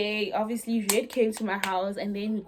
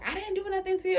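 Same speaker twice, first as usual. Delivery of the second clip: high-pitched mock protest; retold mother's denial; 9 semitones higher, faster than usual. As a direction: imitate a mother defending herself. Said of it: "Red's mom is answering".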